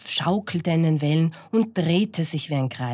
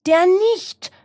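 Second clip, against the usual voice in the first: very high, loud hen squawk; frightened cackling hen; 7 dB louder than usual. Acted. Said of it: "gackerte die Henne erschrocken".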